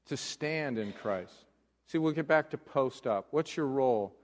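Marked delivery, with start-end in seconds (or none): 0.1-1.3 quiet emphasis. Acted. Quiet emphatic sermon point.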